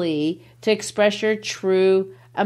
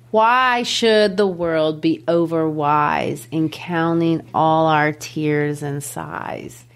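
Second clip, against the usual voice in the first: slow, measured verse recital; poetry reading; 3 semitones lower, slower than usual. Acted. Teacher reads the poem to the class, slow measured recital. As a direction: draw out each stressed word of the verse.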